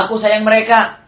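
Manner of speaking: loud, high declaration; Prophet's love. Retold or imitated. Preacher voicing the Prophet's love for workers, loud and high. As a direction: call it out with feeling. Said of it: "kata Rasulullah".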